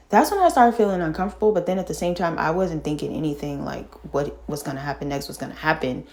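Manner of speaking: ordinary talk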